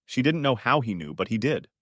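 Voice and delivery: ordinary delivery, nothing marked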